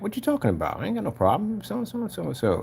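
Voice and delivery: high-voiced mock bafflement; defensive denial. Acts the man's innocent reply.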